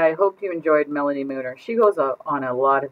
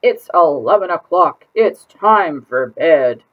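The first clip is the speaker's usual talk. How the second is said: louder, very slow grandpa call; grandfather calling; 9 dB louder, slower than usual. Acted. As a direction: draw out the bedtime call. Grandfather calls to bed.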